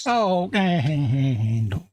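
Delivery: very slow, quavering "Fork handles"; wibbly wobbly. Pretend. Playing with the fork handles gag, wibbly wobbly. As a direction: stretch it out and let the voice wobble. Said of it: "a bit wibbly wobbly at the end".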